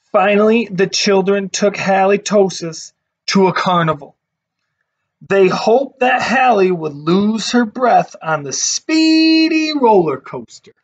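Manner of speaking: put-on lively read-aloud voice for kids; storybook narration. A narrator's reading. Teacher reading a picture book to her class, lively storytelling.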